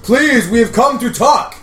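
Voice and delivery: high, slow, full-voiced plea; loudest possible shout. Colvin shouts again to the unseen inhabitants, loudest possible shout. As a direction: shout it out as far as it will carry. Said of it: "the loudest voice he can muster".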